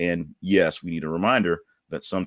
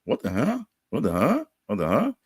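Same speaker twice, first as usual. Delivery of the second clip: 1 mock bewildered; 6 semitones higher than usual. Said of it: "And people go".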